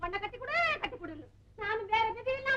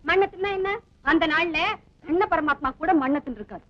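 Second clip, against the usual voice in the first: loud retort; 3 semitones lower, 8 dB louder than usual. Acted. Heated quarrel.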